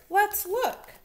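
high, bright little mouse voice; eager mouse. Acted. Molly Mouse urges Billy to search, high and eager. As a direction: pipe it up like a keen tiny mouse. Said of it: "said Molly".

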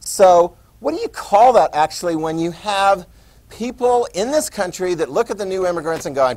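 normal talking voice

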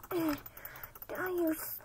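ordinary talk, nothing marked

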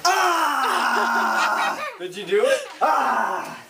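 loud thrilled yelling; excited shout. Real, not acted. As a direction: cheer out in real excitement. Challenge just completed, cheering.

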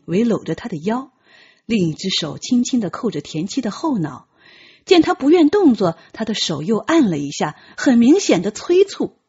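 ordinary talk

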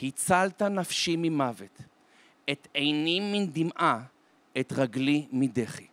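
slow, measured recital of a verse; verse recitation. Acted. Grandfather quotes a psalm to his grandson, slow recitation. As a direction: recite it phrase by phrase, drawn out. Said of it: "הפסוק הולך ככה".

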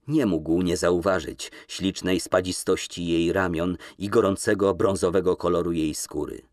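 usual speaking voice

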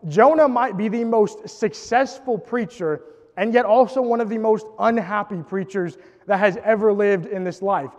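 ordinary talk, nothing marked

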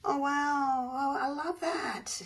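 high, drawn-out delighted wonder; slow gush of real admiration; awed delight. Real, not acted. Artist admiring her new pour, awed delight.

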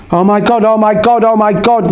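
a touch of wide-eyed panic; mild panicked shout. A narrator's reading. Norva panics after the body drops, mild panicked shout. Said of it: "Norva shouted, staring at me, eyes wide with panic".

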